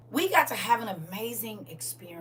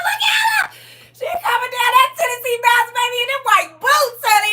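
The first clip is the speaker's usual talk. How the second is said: high, loud whooping cheer; cheering yell; 13 semitones higher, 10 dB louder, faster than usual. Retold or imitated. Internet mama cheering her girl down the runway. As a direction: yell it like a proud mom in the crowd. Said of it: "You hear me stepping in and yelling at it?".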